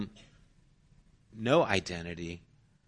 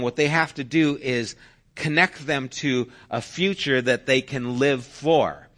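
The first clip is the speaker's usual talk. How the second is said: ordinary talk, nothing marked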